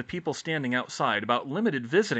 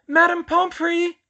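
high, loud, drawn-out call; calling out loudly; 12 dB louder, slower than usual. A narrator's reading. Hermione summoning the nurse, loud high call. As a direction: summon her from across the ward. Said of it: "she called loudly".

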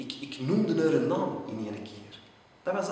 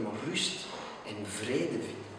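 plain reading voice

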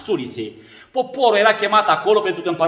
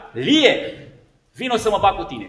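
ordinary talk

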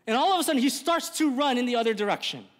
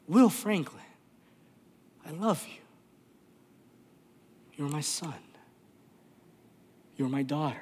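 tender; 6 semitones lower than usual. Acted. Voicing God's love to listeners.

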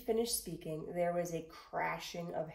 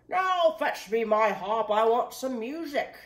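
giant demanding; 4 semitones higher, 11 dB louder than usual. A narrator's reading. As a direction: bark orders like a greedy giant. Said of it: "and then he demanded".

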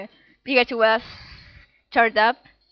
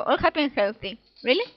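ordinary talk

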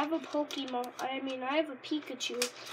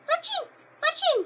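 very high squeaky bird call of the name; Pokemon cry; 8 dB louder than usual. Retold or imitated. Kid imitating the anime Pokemon cry, very high squeak. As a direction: squawk the name like a tiny bird. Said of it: "it went a little like this".

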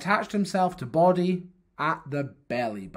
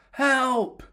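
high-pitched gasping plea; acted gasp of alarm; gasped cry for help; 10 semitones higher than usual. A narrator's reading. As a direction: cry out as if squashed. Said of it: "gasped Head".

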